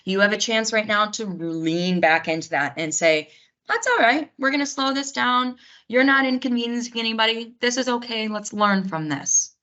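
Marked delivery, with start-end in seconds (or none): 3.7-9.5 higher-pitched soothing tone, easing down; reassuring. Acted. Responder calming a panicked family member, acted reassurance. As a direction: talk a panicked relative gently down.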